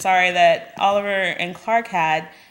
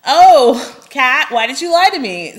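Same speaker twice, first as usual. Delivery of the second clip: mock whine; 3 semitones higher, 7 dB louder than usual. Mocking Clark's hypocrisy.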